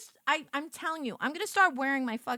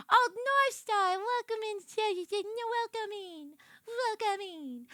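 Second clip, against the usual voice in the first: higher, slow sugary greeting; sweet welcome; 9 semitones higher than usual. Real, not acted. Greeting incoming raiders in persona.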